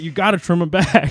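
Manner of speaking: can't stop laughing while quoting; laughing quote. Real, not acted.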